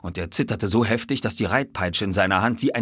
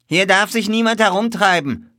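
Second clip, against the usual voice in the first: high, loud warning shout; stablehand's high-pitched holler; called out; 13 semitones higher, 8 dB louder than usual. A narrator's reading. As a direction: shoo strangers off from across the yard. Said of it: "rief er uns zu".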